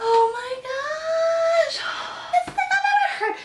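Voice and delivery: overwhelmed with happy tears; tearful excitement. Real, not acted. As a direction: squeal it like you're about to cry. Reacting to faith news.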